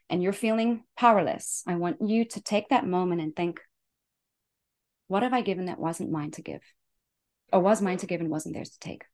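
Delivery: ordinary delivery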